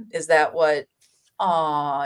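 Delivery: ordinary delivery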